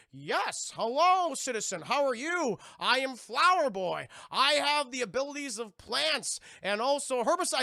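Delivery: grand, loud hero introduction; hammed-up high, declamatory hero voice; Flower Boy. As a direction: proclaim it like a costumed superhero.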